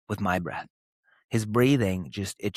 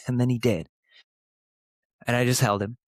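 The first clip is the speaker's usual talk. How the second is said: normal talking voice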